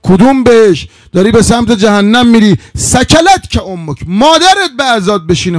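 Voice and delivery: loud, high-pitched scolding of oneself; forceful acted self-reproach; self-rebuke. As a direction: berate yourself in agitated disbelief.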